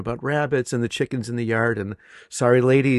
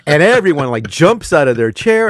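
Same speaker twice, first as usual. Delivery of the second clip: louder, animated telling of the class jumping; excited retelling; 4 semitones higher, 8 dB louder than usual. Real, not acted. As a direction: tell it big, like the room jumped.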